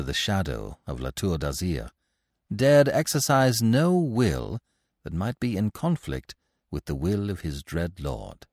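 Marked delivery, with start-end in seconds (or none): none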